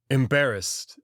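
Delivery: ordinary delivery, nothing marked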